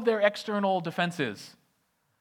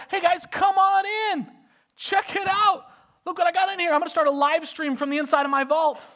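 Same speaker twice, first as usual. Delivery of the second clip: much higher, louder bragging; over-eager host showing his vault; giddy showing off; 10 semitones higher, 7 dB louder than usual. Acted. Hezekiah invites the envoys in, giddy and loud. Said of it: "He's like".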